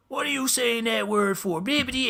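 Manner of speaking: put-on high-pitched, thick Newfoundland accent; mock Newfoundland accent sliding into gibberish; hard Newfoundland accent. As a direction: imitate an indignant man in broad Newfoundland accent. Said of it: "like, hard accent".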